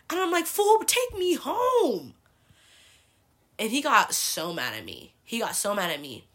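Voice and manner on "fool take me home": high, long-drawn exasperated demand; pissed-off demand. Retold or imitated. Daughter telling her dad to drive her home, acted exasperation. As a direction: wail the demand in mock outrage. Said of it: "I tell my dad that I'm fucking pissed".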